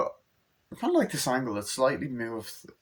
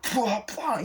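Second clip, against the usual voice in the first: high-pitched spoken fight effects; imitated punch noises; 10 semitones higher than usual. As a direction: mimic blows landing.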